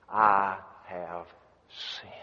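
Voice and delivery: low, halting admission of guilt; acted remorse, hushed and heavy; humbled confession. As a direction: let it sink to almost nothing. Acted king confessing after being accused.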